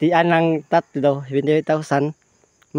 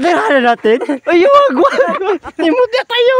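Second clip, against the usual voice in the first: high, laughing protest; 13 semitones higher, 9 dB louder than usual. Real, not acted. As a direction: squeal through laughter.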